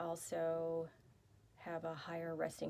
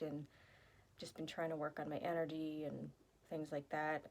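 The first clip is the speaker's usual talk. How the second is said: ordinary talk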